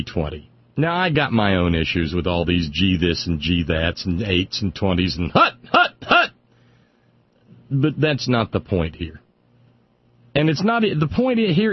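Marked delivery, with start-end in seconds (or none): 5.3-6.3 high, loud barked hut; mock quarterback shout, much higher; mock football call.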